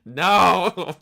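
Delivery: loud anguished no; shouted no. Real, not acted. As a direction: yell it out in protest. Reacting to a sad farm story.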